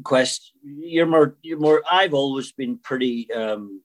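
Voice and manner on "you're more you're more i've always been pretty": ordinary talk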